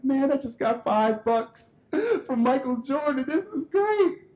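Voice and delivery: put-on high-pitched, giggly childhood glee; thrilled kid. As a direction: gush high like a boy who struck it rich. Boy thrilled with five dollars.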